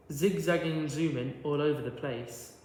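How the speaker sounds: ordinary delivery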